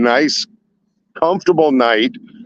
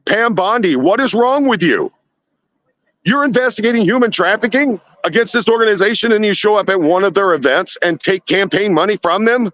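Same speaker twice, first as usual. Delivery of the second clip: indignant rant; 5 semitones higher than usual. Real, not acted. Ranting at official over donations.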